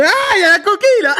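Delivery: high shriek through laughter; laughing shriek. Real, not acted. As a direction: squeal with laughing disgust. Spots eggshell in the drink.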